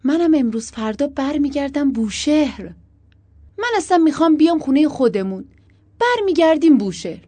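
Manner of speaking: high, loud arguing; heated, forceful insistence; insistent protest. A narrator's reading. Couple's quarrel about going home.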